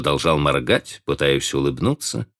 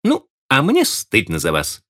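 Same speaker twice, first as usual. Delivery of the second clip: higher, decisive snap; a hint of a sharp rebuke; curt retort; 8 semitones higher than usual. A narrator's reading. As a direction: cut him off firmly. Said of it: "решительно отрезала".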